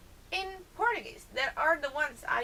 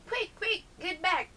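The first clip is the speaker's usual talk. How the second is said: panting dwarf; 8 semitones higher than usual. Acted. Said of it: "Crow panted".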